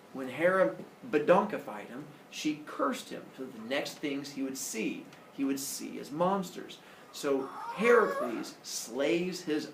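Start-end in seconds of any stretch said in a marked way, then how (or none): none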